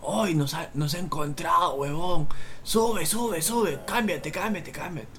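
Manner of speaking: high, frantic mock warning; high-pitched urgency without shouting; imitated urgent calling. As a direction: imitate panicked friends urging haste. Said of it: "y gritan, pes".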